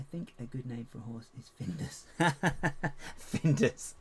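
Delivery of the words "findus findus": loud high laughter; laughing. Real, not acted. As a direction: burst out laughing at the name. Laughing at own joke.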